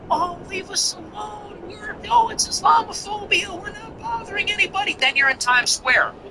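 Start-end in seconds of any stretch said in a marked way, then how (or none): 0.1-4.9 much higher, soft, whiny parody voice; mock whiny victim. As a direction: squeak it like a put-upon victim. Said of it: "So first you go".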